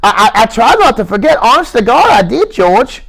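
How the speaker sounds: put-on high stammering childlike plea; Lenny, earnest. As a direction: insist like a scared child. Lenny's held voice.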